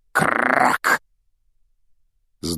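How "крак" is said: cracking noise with rolled r; imitated crash. A narrator's reading. Car smashes into the tree, imitated crash. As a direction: crunch it out as one sudden crack.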